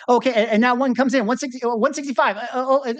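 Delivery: fast, high auctioneer patter. Shown as a demonstration. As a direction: rattle off the bids quickly. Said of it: "I could do the auctioneer voice".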